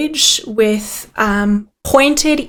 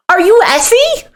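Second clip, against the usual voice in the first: higher-pitched character voice, rising; game character's question; 8 semitones higher than usual. Acted. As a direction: ask it high, checking who this is.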